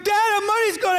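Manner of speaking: high, loud, tearful calling; crying out for dad. Retold or imitated. Boy discovers the stolen money and calls his dad, crying and shouting. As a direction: shout for your dad through tears.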